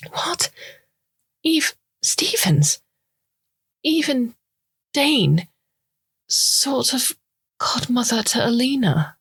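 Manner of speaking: high, searching questions, lightly voiced; puzzled. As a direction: try to place the name, baffled. Sucharita puzzled on the phone.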